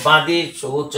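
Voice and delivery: usual speaking voice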